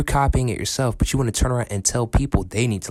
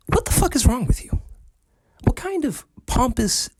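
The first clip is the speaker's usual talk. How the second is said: much higher, incredulous; squeaky outrage; 14 semitones higher than usual. Real, not acted. Ranting vlogger, outraged at the director, in a high incredulous voice. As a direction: let the voice crack up in disbelief.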